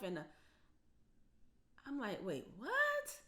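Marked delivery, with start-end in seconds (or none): 2.2-3.2 high, incredulous wait what; shocked disbelief. Retold or imitated. Mother hearing the complaint again, stunned high disbelief.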